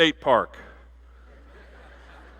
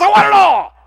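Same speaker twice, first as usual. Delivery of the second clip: very high, very loud demand; eager shout; 11 dB louder than usual. Acted. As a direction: belt it out like greed for everything. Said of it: "But she says".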